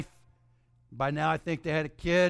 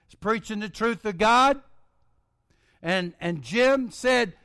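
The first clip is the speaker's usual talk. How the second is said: ordinary delivery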